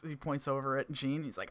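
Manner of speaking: ordinary talk, nothing marked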